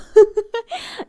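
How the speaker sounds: high, tearful burst of laughter; a choked, emotional giggle; nervous laugh. Real, not acted. Streamer fighting back tears with a high laugh. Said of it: "try not to get emotional".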